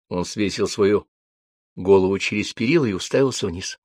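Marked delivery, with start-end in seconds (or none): none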